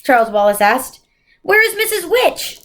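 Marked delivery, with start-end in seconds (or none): 1.4-2.6 put-on much higher, anxious child's call. A narrator's reading. Charles Wallace calls for Mrs Which, higher and anxious. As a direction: call out into the empty air.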